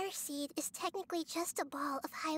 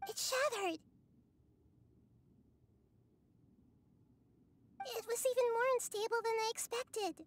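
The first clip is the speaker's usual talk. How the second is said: startled cry, pitched higher; shocked; 6 semitones higher than usual. Acted. Nahida to Paimon over the broken seed, shocked. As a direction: gasp it out in disbelief. Fire seed suddenly breaks.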